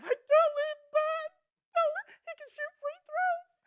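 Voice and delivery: very high, drawn-out tearful wail; mock sobbing. Brother mimicking his sister crying over a sad dog movie, sobbing. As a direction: imitate a little girl bawling dramatically. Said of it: "sobbing on a bed".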